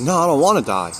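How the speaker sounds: high, loud, desperate no; panicked plea. Retold or imitated. Pleading with the man by his hospital bed, panicked and loud. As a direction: plead frantically for your life.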